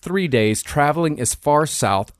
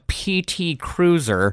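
slow, higher-pitched disdain; slow, scornful emphasis; disdainful; 5 semitones higher, slower than usual. Real, not acted. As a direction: draw out the car's name with open disdain. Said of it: "Why did you say that with such disdain?".

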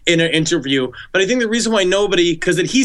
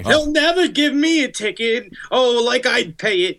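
high-pitched grumbling killer caricature; Son of Sam; 6 semitones higher than usual. Acted. Killer griping about his parking ticket, high cartoon voice. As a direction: complain in a silly, high put-on voice. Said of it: "I'm Son of Sam".

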